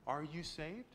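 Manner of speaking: usual speaking voice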